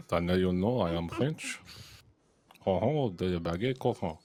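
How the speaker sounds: put-on hammy French accent; tongue flat on teeth, French accent. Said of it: "you wanted French".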